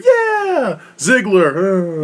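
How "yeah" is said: shrill-free high yelled yeah, much higher; mock crowd cheer. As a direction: cheer each name like a hyped fan.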